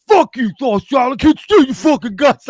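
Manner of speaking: loud, high mock-angry shout; hostile yell. Ex-friend turning on him, a loud hostile yell. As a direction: yell it at him like a former friend turned on you. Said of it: "the one day they're like".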